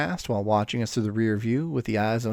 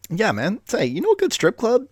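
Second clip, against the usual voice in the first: eager, higher blurt; 10 semitones higher than usual. Acted. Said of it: "Tommy blurted out".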